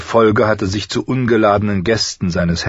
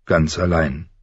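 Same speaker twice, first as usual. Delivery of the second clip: ordinary delivery, nothing marked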